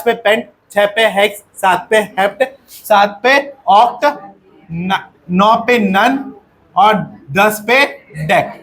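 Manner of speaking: slow, word-by-word dictation pace. Shown as a demonstration. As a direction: spell it out slowly so the class can write. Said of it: "अब ध्यान से समझो". (कक्षा को लिखवाते हुए धीमी गति से)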